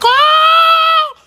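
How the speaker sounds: long high-pitched loud "oh!"; imitated swooning squeal. Cartoon girl spotting a guy, mock high squeal.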